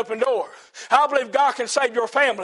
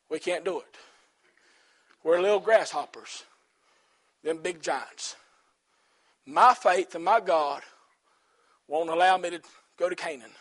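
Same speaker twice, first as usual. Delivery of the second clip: softer, a light whiny defeated voice; mock doubter; 8 semitones lower, 5 dB quieter than usual. Preacher voicing the people who refuse, softer and mildly mocking. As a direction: sound small, like a grasshopper.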